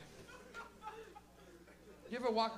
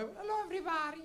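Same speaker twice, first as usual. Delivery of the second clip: high-pitched Portuguese mother impression; imitated Portuguese-accented mother, high; little Portuguese lady; 9 semitones higher than usual. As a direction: greet everyone as a tiny Portuguese mom. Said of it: "she's a little Portuguese lady".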